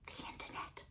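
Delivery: hushed, voiceless mock admission; acted sheepish whisper; whispered confession. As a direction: whisper it like a silly secret. Acts sheepish answer to husband.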